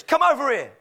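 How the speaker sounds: high-pitched mock parrot summons; bossy call. Parrot summons the pet shop customer, bossily calling.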